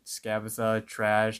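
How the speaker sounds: usual speaking voice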